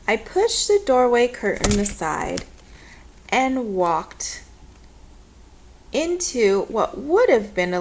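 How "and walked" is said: ordinary delivery, nothing marked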